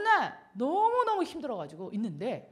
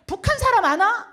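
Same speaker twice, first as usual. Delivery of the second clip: louder, slower mother's question in dialect; a mother asking over the phone, louder and slower; 8 semitones higher, 11 dB louder than usual. Retold or imitated. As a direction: call out like a puzzled mother.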